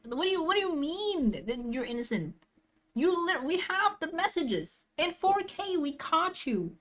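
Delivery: high-pitched disbelief without raising volume; incredulous accusation. Pretend. Viewer challenging the suspect's claim of innocence, incredulous accusation. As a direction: confront him as if caught red-handed.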